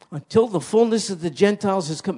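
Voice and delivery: loud, lifted pulpit voice; preacher proclaiming to the flock; fervent preaching. Acted. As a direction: drive the promise home. Preaching at full force.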